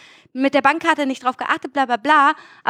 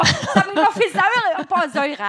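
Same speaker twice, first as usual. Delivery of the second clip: laughing at her slip; 5 semitones higher than usual. Real, not acted. Slips on a word mid-rant.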